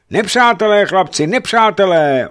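high, very loud repeated warning; cries of alarm. A narrator's reading. Crews on the other ships pass on the alarm, shouting. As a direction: yell it across the water. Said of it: "ozývaly se výkřiky".

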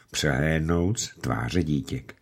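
plain reading voice

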